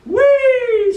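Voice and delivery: high, loud gleeful whee; screaming whee. A narrator's reading. Ball screaming with joy as it flies, high and loud. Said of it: "screams its trajectory".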